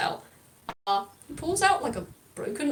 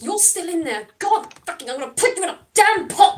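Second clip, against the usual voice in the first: rising loud, higher furious rant; angry rant; 7 semitones higher, 7 dB louder than usual. Acted. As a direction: build to a shout at the crab. [name] yelling at the crab.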